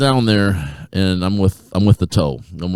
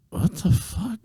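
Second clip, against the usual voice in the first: high, softer incredulous question; bewildered; 5 dB quieter, much slower than usual. Retold or imitated. Reacting to the lady's insult, high bewilderment. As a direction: act baffled, voice lifted high.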